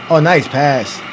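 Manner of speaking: ordinary talk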